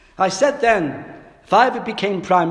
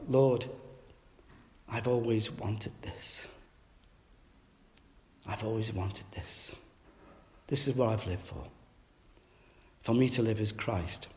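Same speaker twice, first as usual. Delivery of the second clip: quiet inner prayer; 7 semitones lower, 11 dB quieter than usual. Retold or imitated. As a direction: say it softly to God, almost under the breath. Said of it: "he was saying to himself".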